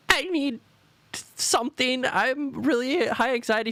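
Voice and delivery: very high, strained dog voice; anxious dog voice. Retold or imitated. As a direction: whine it like a frantic dog. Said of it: "she's more just like".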